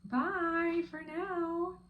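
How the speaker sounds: high, drawn-out goodbye; singsong farewell. Real, not acted. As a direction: stretch the goodbye out high and slow. Singsong sign-off to viewers.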